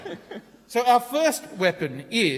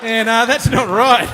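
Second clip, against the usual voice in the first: louder, hammed-up refusal; mock shout; 8 dB louder than usual. Presenter shouting a joke weapon name to the crowd, mock protest. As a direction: belt out the catchphrase. Acting out the gun's catchphrase.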